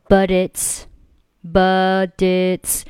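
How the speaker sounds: slow "but it's" joined as "buh-dits"; linked reading. Shown as a demonstration. Teacher showing the fluent option, "but it's" linked slowly. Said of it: "把but和its进行一个连读".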